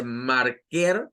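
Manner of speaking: marker in a Latin American Spanish accent with a full er. Shown as a demonstration. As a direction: marker with a Spanish rolled r and clear o, rising. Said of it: "muy acentuado del español latinoamericano".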